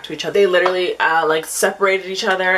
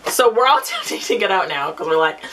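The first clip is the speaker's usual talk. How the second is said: ordinary delivery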